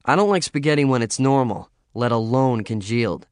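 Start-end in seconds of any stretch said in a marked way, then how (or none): none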